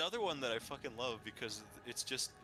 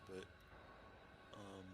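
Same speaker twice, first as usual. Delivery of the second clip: normal talking voice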